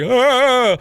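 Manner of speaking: sharp high yelp of pain; mock pain yelp. Yelp recalling the burn, mock pain. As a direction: yelp like the adrenaline hit.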